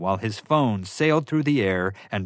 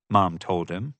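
plain reading voice

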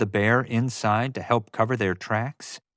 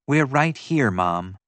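ordinary delivery

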